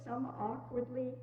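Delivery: usual speaking voice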